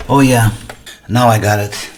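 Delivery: normal talking voice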